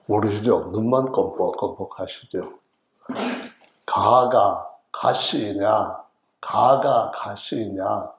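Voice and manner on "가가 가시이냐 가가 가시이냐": each syllable of 가가 가시이냐 drawn out very slowly; slow dialect explanation. Shown as a demonstration. Preacher decoding Gyeongsang for the congregation, very slow Gyeongsang delivery. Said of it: "가가 가시인 가냐 이 말입니다".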